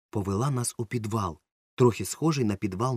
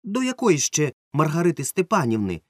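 stern; 7 semitones higher, 5 dB louder than usual. A narrator's reading. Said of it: "так само суворо спитала двірничка".

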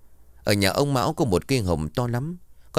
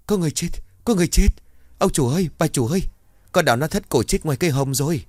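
high, fast frantic yelling; panicked shout; 9 semitones higher, 4 dB louder, faster than usual. Acted. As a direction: scream for the masters in panic. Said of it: "vang lên thất thanh". (hét thất thanh hoảng loạn)

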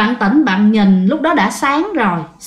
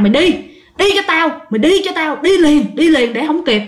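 higher, commanding push; harsh order; 6 semitones higher than usual. Retold or imitated. Ghost woman orders the mother to leave now, harsh. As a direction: drive her away impatiently.

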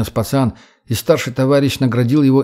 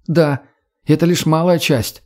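higher-pitched, eager character voice; earnest young woman; 4 semitones higher than usual. Acted. Redhead pitching coexistence to the boy, eager conviction. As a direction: persuade with conviction, pitch raised.

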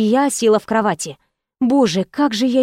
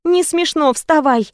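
high-pitched playful scolding; amused indignation; 6 semitones higher than usual. A narrator's reading. Woman telling her joking lover to get up, amused indignation. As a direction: scold him while holding back a laugh. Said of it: "смех так и рвался наружу".

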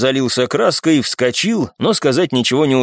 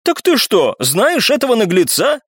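raised-pitch outraged question; mildly outraged outcry; indignant cry; 8 semitones higher than usual. A narrator's reading. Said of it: "вскричал Виконт".

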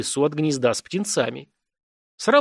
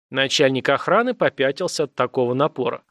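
ordinary delivery, nothing marked

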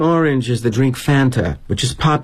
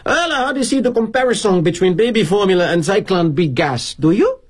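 put-on higher voice with a Cuban accent; Havana-esque accent; 8 semitones higher than usual. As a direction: put on a Havana accent, ending on a rising question. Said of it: "he said in his Havana-esque accent".

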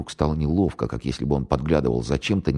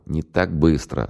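plain reading voice

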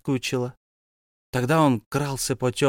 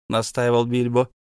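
usual speaking voice

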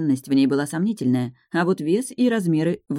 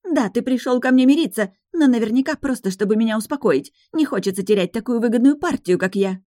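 much higher, louder accusing tirade; angry; 7 semitones higher, 4 dB louder than usual. Acted. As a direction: throw it at him furiously. Fiancée quarrels.

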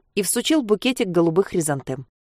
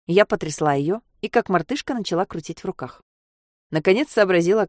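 ordinary delivery, nothing marked

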